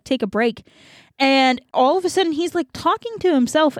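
ordinary talk, nothing marked

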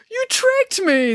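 high, slow, hurt whine; a betrayed simpleton; drawn-out high wail of hurt; wounded Hercules. Acted. Hercules realises trick.

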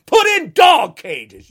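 loud, slow outraged shout; furious shout at the cruelty; angry shout. Real, not acted. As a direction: bellow it in disgust. Angry rant about children.

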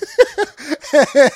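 high burst of laughter; real laughter; loud laugh. Real, not acted. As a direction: crack up out loud. Laughing at retold story.

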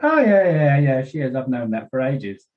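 high, bright-eyed quick agreement; eager agreement. Retold or imitated. Daughter cheerfully confirming dad's suspicion about Jay. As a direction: jump in as if it's obvious. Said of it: "She went".

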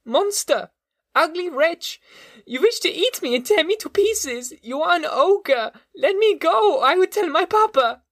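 put-on very high, shrill child's voice hurling insults; a frantic little boy screaming for release; terrified child. A narrator's reading. Boy fighting the monster's grip, screaming insults in high panic. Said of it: "he cried".

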